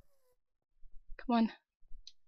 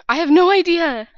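excited outburst; 4 semitones higher, 12 dB louder than usual. Real, not acted. Excited exclamation.

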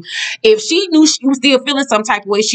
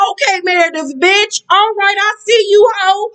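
sassy mock approval; 11 semitones higher than usual. Host cheering on Meredith's clapback, in a high sassy voice. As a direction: pitch it way up and sass her like a fan cheering.

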